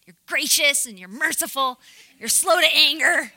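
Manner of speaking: loud, hammed-up indignant Jonah; mock angry. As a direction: throw it at God like an accusation, loudly. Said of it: "Can you imagine him saying this angry?".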